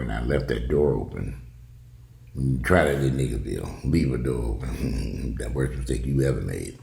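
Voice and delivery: normal talking voice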